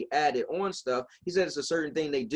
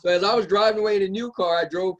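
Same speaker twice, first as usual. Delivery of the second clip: laughing; 5 semitones higher, 5 dB louder than usual. Real, not acted. Laughing through the story's payoff.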